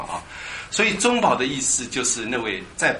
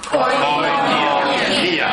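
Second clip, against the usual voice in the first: "Koinonia" very slow, held high for the class; drawn-out repeat drill; 13 semitones higher, 4 dB louder, much slower than usual. Shown as a demonstration. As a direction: lead learners through a long, high "Koi-no-nia". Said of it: "再念一次来".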